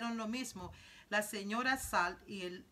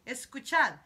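llamada en voz alta; 4 semitones higher, 10 dB louder than usual. Acted. Wonka calls attention to the singing.

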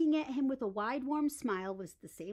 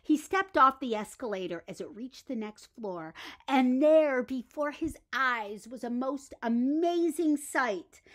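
ordinary delivery, nothing marked

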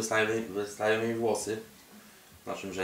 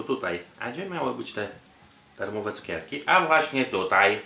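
ordinary talk, nothing marked

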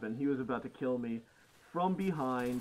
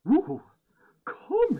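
sharp surprised ooh; 11 dB louder than usual. Real, not acted. Reacting to hit.

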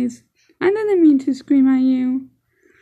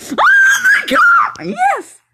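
high, loud screams of fright; panicked screaming; 5 dB louder than usual. Real, not acted. Kid gaming alone, startled by a monster, screaming. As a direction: scream in real panic at the game. Real game scare, screaming.

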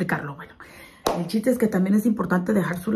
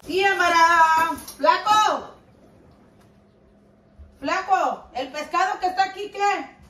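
calling out; 10 semitones higher than usual. Real, not acted. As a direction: call across the house. Calling husband from kitchen.

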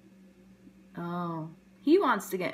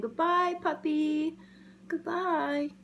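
higher, sing-song goodbye; kittens saying goodbye; 7 semitones higher, slower than usual. Acted. Kittens waving goodbye to the puppy, higher and sing-song. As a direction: wave it off brightly. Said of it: "They're saying".

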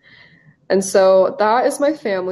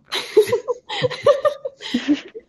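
high giggling laugh at her own slip; a burst of high, flustered giggles; embarrassed laugh; 14 semitones higher than usual. Real, not acted. Embarrassed by wrong picture.

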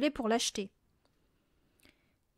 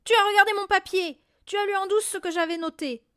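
furious accusation; 8 semitones higher, 9 dB louder than usual. A narrator's reading. Father accusing Mathilda.

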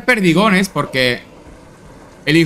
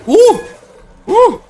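startled yelp; 5 dB louder than usual. Real, not acted. Startled during combat.

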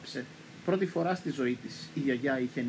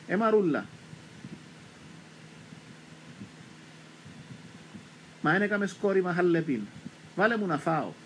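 calling out; 4 semitones higher than usual. Retold or imitated. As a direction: call across the yard. Grandmother calling for food.

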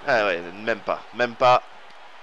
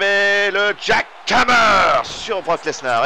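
slow, loud, higher stretched yell; excited shout; 5 semitones higher, 4 dB louder, much slower than usual. Real, not acted. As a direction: roar the finisher out. Finisher commentary. (cri de commentateur, lent et fort)

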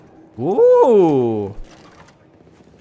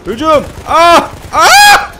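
much higher and louder charging shouts; battle cry; 13 semitones higher, 11 dB louder, much slower than usual. Real, not acted. Leading the charge with high, loud yells. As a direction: yell the charge at full force.

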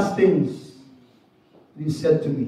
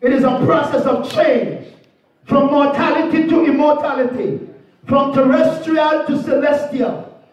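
rising, higher pulpit proclamation with hallelujahs; preaching; 4 semitones higher than usual. Acted. Preacher wrapping up a funeral sermon, rising proclamation. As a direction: lift the congregation, declare death is not the end.

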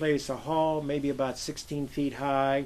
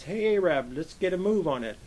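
calling out; 5 semitones higher, 6 dB louder than usual. Retold or imitated. Sailor calling out carelessly.

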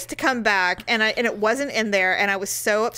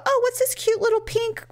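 higher, mock-delighted finder; imitating a greedy fool; 12 semitones higher than usual. Imagining a driver finding her pink passport, gleeful. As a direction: play a sly stranger finding a prize. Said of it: "some fool would go and go".

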